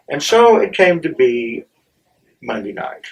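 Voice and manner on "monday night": plain reading voice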